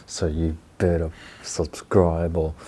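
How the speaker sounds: slow, low, mock-menacing warning; mock threatening. Vlogger warning viewers to subscribe, mock threatening. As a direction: draw it out as a playful threat. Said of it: "threatening is the best way".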